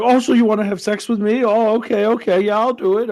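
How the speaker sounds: high, loud mock Scottish host voice; imitating Craig Ferguson's flirting. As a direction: ham up a boozy talk-show host egging women on. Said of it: "He'd be like".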